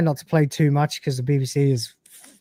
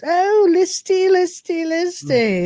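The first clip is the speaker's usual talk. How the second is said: much higher, slow, sing-song smug teasing; 4 dB louder, slower than usual. Retold or imitated. Rimmer gloating over panicking Lister, smug sing-song. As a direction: drag out the name with mock sweetness. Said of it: "keep that smugness in mind".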